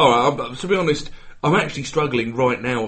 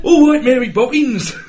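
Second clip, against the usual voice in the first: high, loud, drawn-out fake English accent; mock an American's awful English accent; bad English accent; 12 semitones higher, 9 dB louder, slower than usual. Mocking Americans trying English, hammed-up bad English accent. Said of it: "trying to do an English accent".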